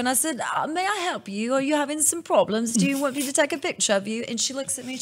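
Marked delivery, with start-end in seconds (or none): 0.5-4.2 put-on high, eager English accent offering help. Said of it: "start talking an English accent".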